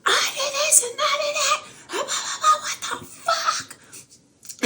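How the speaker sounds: very high squealing imitation of a panicked girl; mock shrieking girl. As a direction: shriek it way up high, laughing through it. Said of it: "another girl in the parking lot shrieking".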